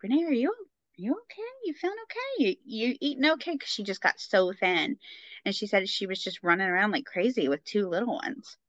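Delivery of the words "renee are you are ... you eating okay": high, soft imitation of worried concern. Mother-in-law checking on thin mom, worried imitation. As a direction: fret gently in a much higher voice. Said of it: "she got a little worried about me".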